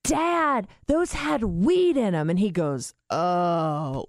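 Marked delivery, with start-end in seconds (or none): 0.0-2.3 alarmed. Retold or imitated. Daughter telling dad about the brownies, alarmed. 3.1-4.0 imitating a dim, dazed realisation; dazed stoned dad. Dad learning the brownies had weed, dazed. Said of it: "like this".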